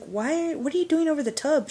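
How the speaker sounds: high, incredulous questioning; baffled. Retold or imitated. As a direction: ask in disbelief. Friend confused about the toilet.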